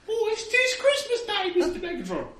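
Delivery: high, loud, squeaky Cockney urchin; small Cockney child. Acted. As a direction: pipe up as an eager little Cockney kid. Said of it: "him to be a small Cockney child".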